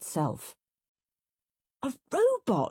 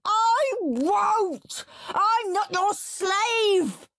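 high, drawn-out screamed refusal; screaming tantrum; 4 dB louder, much slower than usual. A narrator's reading. Henry refusing to be his parents' slave, screaming. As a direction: scream it like a child kicking on the sofa. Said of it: "kicking and screaming on the sofa".

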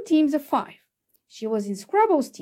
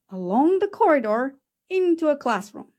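ordinary delivery, nothing marked

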